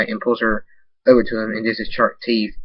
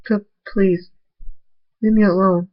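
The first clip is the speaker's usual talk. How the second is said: frightened plea; 9 semitones higher than usual. Acted. Fluttershy begs Nightstorm.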